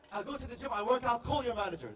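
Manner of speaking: insist, outraged; indignant. Retold or imitated. Customer demands the manager, indignant.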